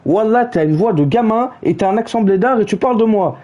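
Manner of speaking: ordinary talk, nothing marked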